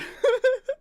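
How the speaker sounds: squealing laugh; high burst of laughter. Real, not acted. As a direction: crack up high. Laughs again.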